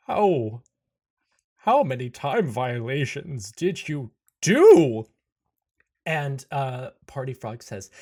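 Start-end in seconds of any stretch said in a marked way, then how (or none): 0.1-5.0 loud, slow, incredulous question; appalled demand. Acted. Tempest questions the frog about time violations, appalled. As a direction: draw it out to a loud finish.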